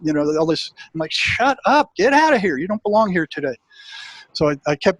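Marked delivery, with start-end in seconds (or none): 1.1-3.5 much higher, sharp mock yell that eases off by the end; retold shout. To the fearful voice in his head about snakes, a high mock yell. Telling his doubting mind off.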